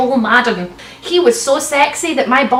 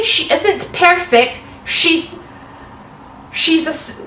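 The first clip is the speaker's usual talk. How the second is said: ordinary talk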